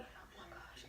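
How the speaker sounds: breathy whispered aside. Real, not acted. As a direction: hush it under your breath. Remembering painful chewing.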